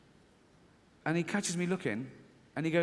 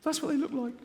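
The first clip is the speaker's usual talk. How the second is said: high, half-laughing panicky voice; fearful inner voice; 10 semitones higher than usual. Retold or imitated. Comedian voicing his fearful side, high and nervous. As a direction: squeak it out like a nervous wreck. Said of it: "this one goes".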